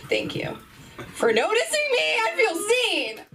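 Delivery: mock tearful gratitude. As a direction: pretend to choke up with gratitude. Acted reply to critical boss.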